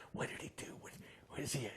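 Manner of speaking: breathy whisper, asked in secret; whispered nosy question; hushed question. Retold or imitated. Excited customs guy asks secretly.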